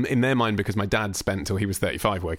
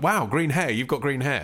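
excited; 6 semitones higher than usual. Retold or imitated. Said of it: "they're like".

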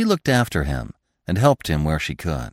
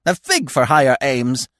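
higher-pitched, jaunty dismissal; cheerful cry; 6 semitones higher, 4 dB louder than usual. A narrator's reading. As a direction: toss it off merrily, like a carefree bird. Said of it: "he cried cheerfully".

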